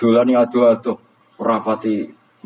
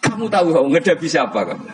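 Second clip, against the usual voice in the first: Umar's defiant challenge; 8 semitones higher than usual. Acted. Umar confronts Mungkar Nakir.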